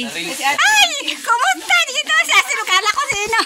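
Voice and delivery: high, loud delighted surprise; surprised greeting. Real, not acted.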